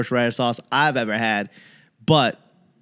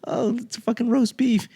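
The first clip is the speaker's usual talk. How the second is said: a hint of a higher, sneering voice; mock dismissive; 10 semitones higher than usual. Comic mimicking a critic sneering at roast beef. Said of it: "there was".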